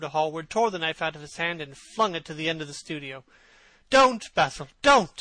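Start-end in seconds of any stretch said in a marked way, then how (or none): 3.9-5.2 loud, high desperate cry; cried out. A narrator's reading. Dorian cries out to stop Basil, loud and high. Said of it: "he cried".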